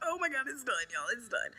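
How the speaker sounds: high, loud thrilled cry; excited. Real, not acted. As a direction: shout the joy of finishing. Finished the drawing.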